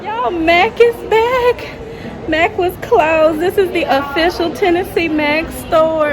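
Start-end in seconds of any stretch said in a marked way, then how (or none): 0.0-2.3 high, slow thrilled announcement; drawn-out excitement. Real, not acted. Vlogger telling viewers the Mac store reopened, high and slow with joy. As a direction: stretch it out, overjoyed the store is back.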